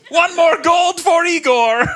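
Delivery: slow, loud, high mock Russian announcer; Russian accent. Russian announcer cheering the dog's win, in a Russian accent. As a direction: crow it like a proud Russian commentator.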